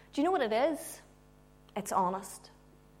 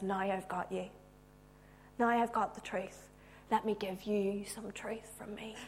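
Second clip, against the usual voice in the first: very soft, near-whispered reassurance; gentle, private murmur; intimate confiding; 2 semitones lower, 9 dB quieter than usual. Acted. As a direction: drop to a hush, as to one person. Jesus speaking intimately to one person.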